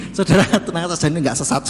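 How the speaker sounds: chuckling through the words; calm them down while still laughing; amused reassurance to the congregation. Real, not acted.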